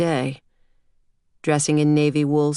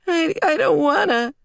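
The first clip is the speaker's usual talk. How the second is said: put-on very high, reluctant small-child voice; whiny little girl; 13 semitones higher than usual. A narrator's reading. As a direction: whine it like a sleepy preschooler. Sleepy small girl refuses waking.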